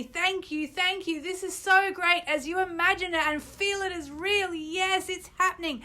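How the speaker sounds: over-the-top gratitude with gasps of delight; frenzy of happiness. Shown as a demonstration. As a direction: burst with giddy joy as if the wish came true. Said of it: "Say it out loud with me".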